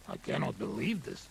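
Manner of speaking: quiet disbelief. Acted. Disbelieving aside.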